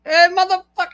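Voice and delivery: sudden high shouted taunt; drunk yell. Retold or imitated. Buddy yelling at a shipmate before swinging: loud, high shout. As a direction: yell it out like a drunk sailor on the attack.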